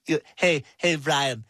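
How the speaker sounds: ordinary talk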